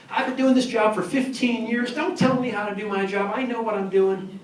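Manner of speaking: know-it-all. Retold or imitated. Imitating know-it-all people.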